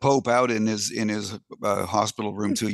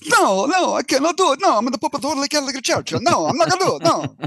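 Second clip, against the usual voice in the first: a much higher, louder, hammed-up put-on accent; the Pope wailing his refusal in a put-on accent; mock accent Pope; 14 semitones higher, 5 dB louder than usual. Pope refusing the assistant, high and loud in a mock accent. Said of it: "I'm gonna do an accent too".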